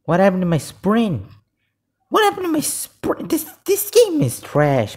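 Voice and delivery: high, loud cry of frustration; outraged complaint. Real, not acted. Gamer whose sprint failed, outraged. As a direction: wail it in rising outrage.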